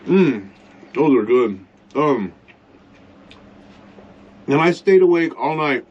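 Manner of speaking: talking while chewing; words around a mouthful of food; mouth full. Real, not acted. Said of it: "Sorry for talking, with my mouth full here".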